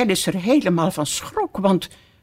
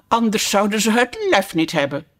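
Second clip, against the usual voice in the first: plain reading voice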